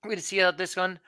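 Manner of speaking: normal talking voice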